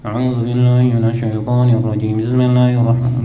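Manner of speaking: mumbled recitation as if gargling; mouthful mumble, as if gargling water; gargled mumbling. Shown as a demonstration. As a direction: recite like rinsing your mouth, words swallowed. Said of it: "Kayak orang kumur-kumur".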